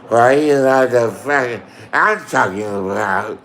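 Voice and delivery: lightly voiced drunk rambling; drunk Michelle impression. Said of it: "she talks like that".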